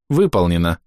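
lightly voiced machine reply; neural network voice. A narrator's reading. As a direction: say it like a computer confirming.

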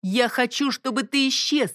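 high defiant cry; shout. Acted. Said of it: "крикнула Бонни". (крик)

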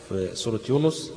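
ordinary delivery

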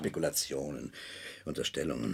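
low, quiet, defensive mutter; dismissive, dropped low and soft; muttered dismissal. Acted. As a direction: wave it away under the breath. Defensive man dismisses her insinuations.

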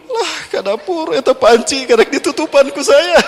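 high, startled wife voice; a hint of a startled wife; surprised wife. Wife discovering the pot in the kitchen, high and startled.

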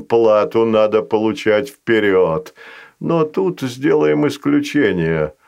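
a patient creature striking a deal. Acted. Narrator voices Fazanol.

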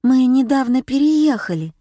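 timid babble. A narrator's reading. Zhenya explaining her move to the scary neighbour, timid babble. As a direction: pipe up nervously as a young girl. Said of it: "пролепетала Женя".

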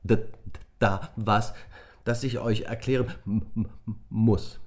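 nervous stutter on first sounds; boy stammering to his friends. A narrator's reading. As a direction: stumble over every start. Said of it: "dass er nicht aufhören würde zu stottern".

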